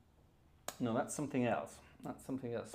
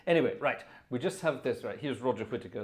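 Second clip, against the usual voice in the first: ordinary talk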